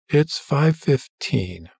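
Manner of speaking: slow, dull and flat-voiced; monotone. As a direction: repeat it bored and slow. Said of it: "repeated the same statement in an even more monotone voice".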